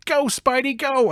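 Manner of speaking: high, excited cheer; root for the hero from the crowd; cheering bystander. Acted. Bystander cheering Spidey on, high and excited. Cheering citizen voiced in recap.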